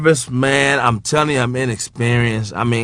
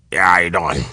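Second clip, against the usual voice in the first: put-on low, muffled old-timer grumble; low grudging greeting, in imitation; imitating older comedian; 9 semitones lower than usual. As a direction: answer low and unbothered. Imitating Redd Foxx's low reply.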